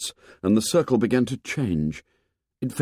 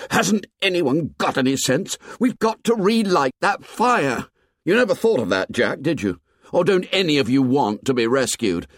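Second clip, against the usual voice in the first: exasperated attack; 8 semitones higher than usual. Acted. Ralph scolding the boys about the fire, exasperated. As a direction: lash out at the whole assembly. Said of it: "exasperation ... energy to attack".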